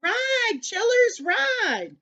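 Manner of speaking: high-pitched urging, falling on the last word; excited cry. A narrator's reading. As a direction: urge the riders on urgently. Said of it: "cried Dan, Grandude".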